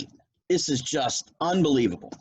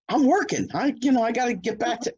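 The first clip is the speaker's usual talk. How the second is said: much higher, hurried protest; flustered excuse; 9 semitones higher than usual. Retold or imitated. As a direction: squeak out an excuse to get away. Speaker's own retold excuse.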